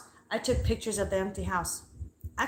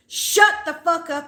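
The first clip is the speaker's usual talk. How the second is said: slow, loud, higher shout; louder yell; 8 semitones higher, 8 dB louder, slower than usual. Retold or imitated. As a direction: yell it slowly to drown her out. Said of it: "my voice got louder than hers".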